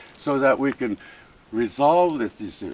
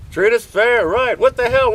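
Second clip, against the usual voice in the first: rising angry shout; 9 semitones higher, 4 dB louder than usual. Real, not acted. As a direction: voice climbing higher and louder in outrage. Angry protest at officials.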